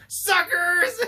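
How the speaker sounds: high, loud gloating yell; mock-taunt with a sneer; hammed-up villain cry of "suckers". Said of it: "He goes".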